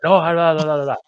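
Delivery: mocking la la la. Brushing off critics, fingers in ears.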